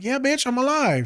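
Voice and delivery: very high-pitched exasperated retort; exasperated reply. Retold or imitated. Answering a worried client by text, exasperated. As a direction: squeal it in mock exasperation.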